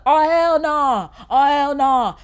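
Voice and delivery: loud, high, drawn-out protest; retold shock, hollered slowly; emphatic refusal. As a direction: belt out an exaggerated objection. Retelling shocked reaction to episode.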